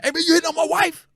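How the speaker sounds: high, loud mock confrontation; angry husband. Husband confronting the comic over his wife, high and loud. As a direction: play the jealous husband squaring up.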